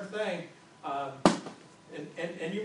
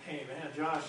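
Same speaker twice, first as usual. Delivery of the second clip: sincere apology; 5 semitones lower, 5 dB quieter than usual. Pretend. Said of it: "what I do is go".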